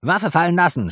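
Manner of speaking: high, loud police command; shouting. A narrator's reading. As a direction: yell the order from the door. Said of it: "schrie eine andere Stimme".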